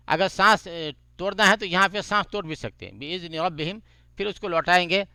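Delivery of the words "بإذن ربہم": Quran recitation. Shown as a demonstration. Recites repeated phrase.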